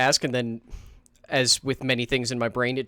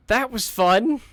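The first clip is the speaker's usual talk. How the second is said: much higher, louder mock glee; sarcastic excitement; 9 semitones higher, 6 dB louder, slower than usual. Shown as a demonstration. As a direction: overdo the cheer.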